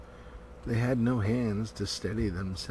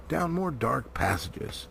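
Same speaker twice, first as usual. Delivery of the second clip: plain reading voice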